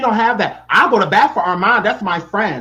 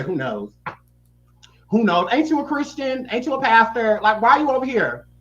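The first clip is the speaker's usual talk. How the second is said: ordinary talk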